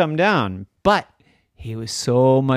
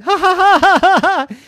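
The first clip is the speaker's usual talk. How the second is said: high loud teasing cackle; mocking laugh; 10 dB louder than usual. Sister laughs at her brother from below. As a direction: laugh down at him like a gloating sister. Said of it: "his sister laughing".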